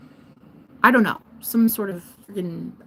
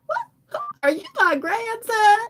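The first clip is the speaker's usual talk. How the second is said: put-on old lady; 13 semitones higher than usual. Old lady questioning her rescuer, high and confused. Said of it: "this old lady".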